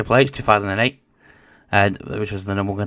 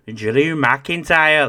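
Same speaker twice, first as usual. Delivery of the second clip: slow, drawn-out Scottish accent on the name; rolled Scottish wrestler-announcer accent; 3 semitones higher, 3 dB louder, much slower than usual. Shown as a demonstration. Podcaster doing a Scottish accent while reading a list. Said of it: "that was my Scottish accent".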